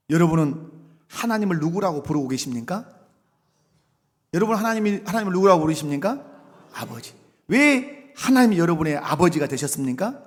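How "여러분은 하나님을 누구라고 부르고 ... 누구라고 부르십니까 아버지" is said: usual speaking voice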